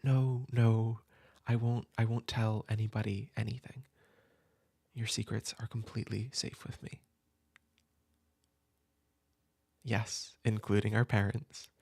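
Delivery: put-on a gentle older sibling comforting a soaked kid at midnight; an older sibling at midnight, welcoming and comforting the upset kid in from the rain; caring older sibling. Plays a caring sibling at night.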